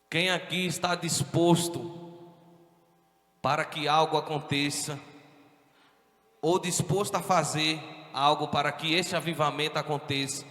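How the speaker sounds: loud, fervent pulpit preaching; preaching at full force. Acted. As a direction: stir up the church, full voice. Pastor exhorts congregation loudly. (pregação inflamada em voz alta)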